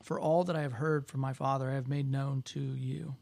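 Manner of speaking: soft, reverent prayer voice. Acted. Scripture reading and closing prayer.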